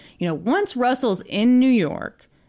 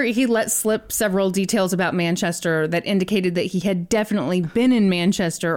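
usual speaking voice

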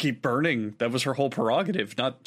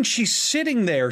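usual speaking voice

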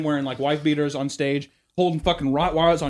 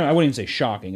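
normal talking voice

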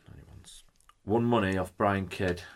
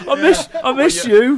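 high laughing mock plea; 10 dB louder than usual. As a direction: laugh through a fake lament. Mock promo to Brian Kidd.

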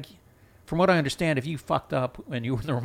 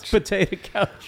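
mock [name]; 9 semitones higher, 4 dB louder than usual. Echoing [name]'s flubbed line.

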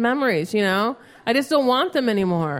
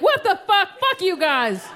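mock-angry phone yell, pitch jumping on the opening; light comic re-enactment of an outburst; retold yelling; 5 semitones higher than usual. Comic calling up Pizza Hut to complain, retold yelling. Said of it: "And I just started yelling".

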